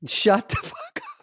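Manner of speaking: very high squeaky disbelief; shocked disbelief. Real, not acted. Cohost stunned by the room service bill, shocked disbelief.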